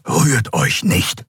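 tense, drawn-out command. A narrator's reading. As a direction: order them slowly, deliberately not to move. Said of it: "befahl Keru".

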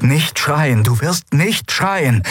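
desperate self-command. A narrator's reading. Captain orders herself not to scream under torture, desperate. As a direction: insist on it as the pain mounts. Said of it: "befahl sie sich".

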